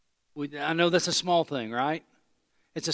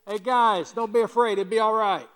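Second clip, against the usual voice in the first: mock yell across lake; 7 semitones higher, 5 dB louder than usual. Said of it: "yelled across the lake".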